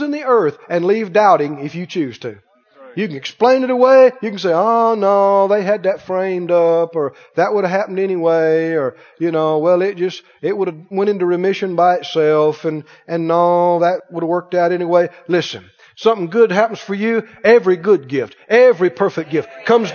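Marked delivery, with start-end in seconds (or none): 4.5-7.0 droning, unimpressed monotone; dismissive doubter. Acted. Doubter explaining away a miracle, droning dismissal. 7.3-8.7 droning, unimpressed monotone; dismissive doubter. Acted. Doubter explaining away a miracle, droning dismissal. 9.6-15.1 droning, unimpressed monotone; dismissive doubter. Acted. Doubter explaining away a miracle, droning dismissal.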